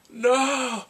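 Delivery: high, loud drawn-out protest; dismayed cry. Retold or imitated. Viewer learns of the cancer, loud dismayed cry. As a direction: cry out in mock despair. Re-enacting her dismay at episode.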